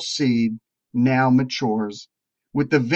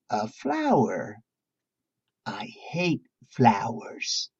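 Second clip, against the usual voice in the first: lower, softer, slow sneer of a feisty little dog; grumpy dog voice; 4 semitones lower, 7 dB quieter, slower than usual. Acted. Preacher voicing the feisty dog in the parable, low and slow. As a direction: growl it out low and slow like a dog who hates flowers.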